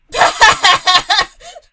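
very loud, high burst of laughter; big laugh. Real, not acted. Gamer the only one among friends, loud cackling laugh.

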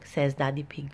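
usual speaking voice

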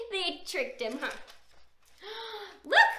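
usual speaking voice

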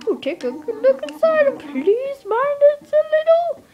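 high squeaky character. Pretend. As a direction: coo it in a tiny voice. Voicing the house guide.

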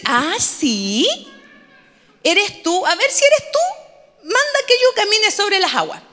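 high-pitched, hammed-up challenge; mock disbelief, voice climbing high on the dare; Pedro incrédulo. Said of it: "lo pone en duda y le dice". (incredulidad fingida, desafiante)

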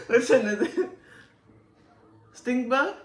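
high giggly laughing question. Real, not acted. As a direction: ask it through laughter. Laughing at the stink bug bean.

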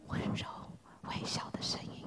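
whispered gently, barely voiced; soft whisper. Shown as a demonstration. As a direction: breathe the words like a still small voice. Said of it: "是一个". (轻声耳语)

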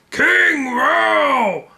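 very high loud hail; shouting. Acted. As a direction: hail the king at full voice. Crowd hails the king.

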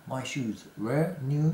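my shoes were new slowly, rising; slow model sentence. Shown as a demonstration. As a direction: read it out slowly. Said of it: "were not".

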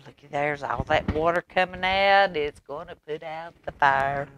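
plain reading voice

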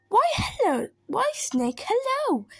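high, swooping hello; sing-song cheery greeting; breezy greeting. Acted. Mouse greets snake before the Gruffalo, breezy.

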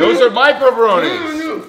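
drawn-out, high possessive claim; possessive whine. Real, not acted. Defending his pepperonis, drawn out.

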